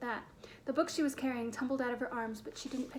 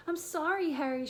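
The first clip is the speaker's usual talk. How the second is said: higher, louder plaintive wail; 4 semitones higher, 4 dB louder than usual. Acted. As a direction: wail the apology in dismay. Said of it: "she wailed".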